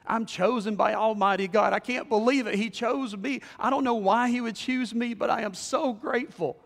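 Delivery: elated preacher's testimony; joyful amazement. Acted. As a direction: exclaim it, overjoyed at being chosen. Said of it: "leapt for joy".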